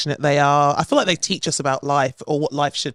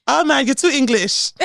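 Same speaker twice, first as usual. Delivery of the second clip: Vincentian driver; 10 semitones higher, 3 dB louder than usual. Retold or imitated. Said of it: "they were like".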